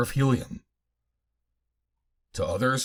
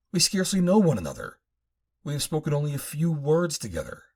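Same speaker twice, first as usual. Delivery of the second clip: a touch higher, doubtful woman's voice; lightly voiced woman; 4 semitones higher than usual. Woman doubting Vordage's love, lightly voiced. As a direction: let her doubt gently, lightly voiced.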